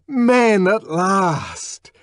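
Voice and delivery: high, loud and very slow; exultant cry. A narrator's reading. Savage exulting as Helmholtz joins the fight. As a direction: drag it out in triumph.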